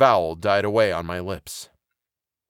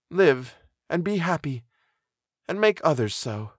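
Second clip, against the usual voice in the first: tender farewell in a raised female character voice; high, earnest goodbye, softening at the end; half-suppressed emotion; 8 semitones higher than usual. A narrator's reading. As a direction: hold back the tears while blessing a friend. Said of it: "in a voice of half-suppressed emotion".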